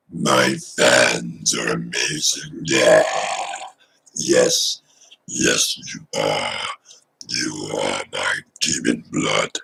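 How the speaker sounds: very low, slow, breathy possessed growl; demon voice. Shown as a demonstration. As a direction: speak like a demon taking over. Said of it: "It's like a weird reverse talk".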